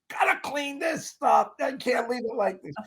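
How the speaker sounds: high, strained tyrant rant; hammed-up high-pitched outburst over a mess; losing it. Actor recalls losing it over a messy house, high-pitched rant. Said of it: "I would lose it".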